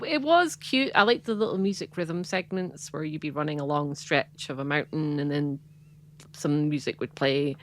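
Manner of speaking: ordinary delivery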